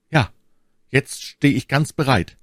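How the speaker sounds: lifted, eager declaration; involuntary enthusiasm. A narrator's reading. D'Artagnan offers himself to My Lady, enthusiastic. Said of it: "rief D'Artagnan voll unwillkürlicher Begeisterung".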